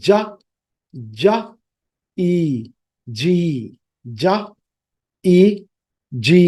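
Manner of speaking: জা, ই, জি split into separate sounds; teacher blending sounds; phonics breakdown. Shown as a demonstration. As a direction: spell out জা then ই then জি slowly. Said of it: "But phonetic sound হচ্ছে".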